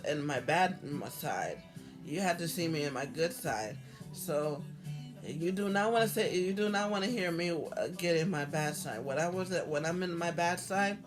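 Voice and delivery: ordinary talk, nothing marked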